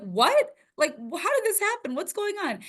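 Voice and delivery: shocked parents. Retold or imitated. Parents hearing about the free scholarship, stunned and high. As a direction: pitch it way up in shock. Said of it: "They're like".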